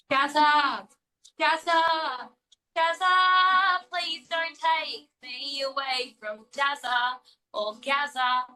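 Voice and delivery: sung in broad Aussie accent; broad Australian. Shown as a demonstration. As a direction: belt it like an ocker Aussie. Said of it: "Say no more".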